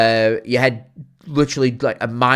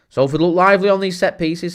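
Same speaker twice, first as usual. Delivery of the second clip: excited commentary; 6 semitones higher than usual. Real, not acted. Commentating on the match.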